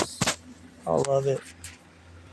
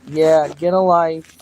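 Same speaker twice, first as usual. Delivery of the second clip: loud put-down; 4 dB louder than usual. Real, not acted. Dismissing a hater on live.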